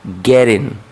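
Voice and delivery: like an American says getting. Shown as a demonstration. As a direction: say गैरिन, the t flapped and the final g dropped. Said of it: "क्या कहेंगे?".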